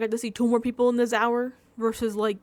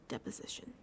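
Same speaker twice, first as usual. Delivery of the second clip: Deposition whispered under breath; whispered practice; 17 dB quieter than usual. Shown as a demonstration. Practising the hard word, she whispers Deposition. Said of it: "I hate wanting to go disposition".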